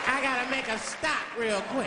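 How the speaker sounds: high, slow driver voice; driver announcing detour. Acted. Driver tells passenger of detour.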